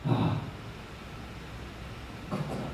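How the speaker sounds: very soft, dawning sigh; hushed realization. Acted. Preacher imagining Jonah finally understanding God, hushed realization. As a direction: let it sink in, barely voiced.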